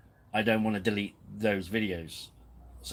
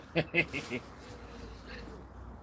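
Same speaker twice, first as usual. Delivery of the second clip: soft high giggle trailing into breath; 7 semitones higher, 7 dB quieter than usual. Real, not acted. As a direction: giggle quietly and let it tail off. Laughs after saying sure.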